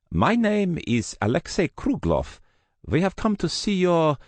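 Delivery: put-on Russian-accented thug, courteous on the surface. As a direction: speak as a polite Russian with a strong accent. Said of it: "he had a strong accent, Russian".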